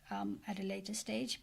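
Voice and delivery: ordinary talk, nothing marked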